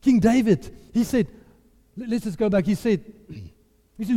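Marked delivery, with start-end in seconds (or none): none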